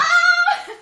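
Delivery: very high, loud delighted scream; excited shriek. Retold or imitated. Viewer reliving the movie twist, shrieking with glee. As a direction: let out a thrilled laughing shriek. Reacting to upside-down camper van.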